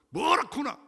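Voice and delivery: high, loud gruff retort; mock gruff husband. Preacher voicing a husband snapping at his wife. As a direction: bark it back like a blunt husband.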